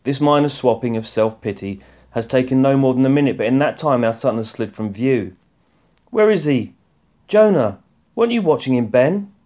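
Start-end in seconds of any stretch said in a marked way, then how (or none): 6.1-9.3 alarmed call. Acted. Emma panicking, son missing.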